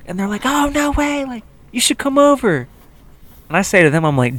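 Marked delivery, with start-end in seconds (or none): 0.4-2.7 high-pitched mock girly invitation; drunk party girls. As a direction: imitate tipsy girls, pitched way up. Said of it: "one chick who's drunk".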